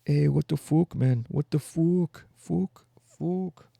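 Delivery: high, hammed-up Irish cursing; bad Irish accent. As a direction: keep retrying the curse in an Irish brogue. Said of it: "I can't do an Irish accent".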